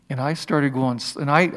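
normal talking voice

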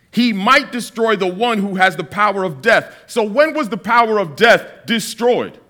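normal talking voice

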